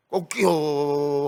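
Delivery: long drawn-out imitated cockcrow; rooster crow. Preacher imitating the rooster at Peter's denial, drawn-out crow. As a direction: crow slowly like a rooster, stretching the last sound. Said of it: "하면서 우는 겁니다".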